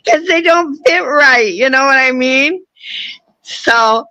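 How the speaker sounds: laughing through the words; chuckling while explaining. Real, not acted. Laughs at own denture story.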